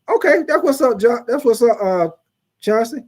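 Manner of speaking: high, loud okay; light up at the comment; excited approval. Real, not acted. Host reacting to a viewer's comment, excited.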